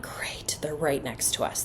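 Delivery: retold thought, dropped almost to a whisper; muttered thought. Mom noticing the family parked beside her, muttered under her breath. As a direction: mutter it softly to yourself. Inward grumble at neighbours parked alongside.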